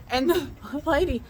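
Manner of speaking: ordinary talk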